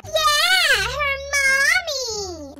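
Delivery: sneering, laughing, high and loud; mocking laugh. Bully laughing at Mackenzie for bringing her mommy. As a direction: drag out mommy to mock her.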